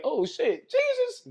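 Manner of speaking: loud, high astonished cry; meeting Jesus face to face, stunned; shocked. Pretend. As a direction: gasp it out in disbelief.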